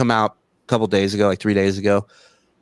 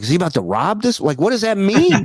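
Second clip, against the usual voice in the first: higher-pitched mock alarm; retold scare; 9 semitones higher than usual. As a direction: act out rising, nervous confusion. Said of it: "and I got scared".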